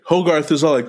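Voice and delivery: ordinary delivery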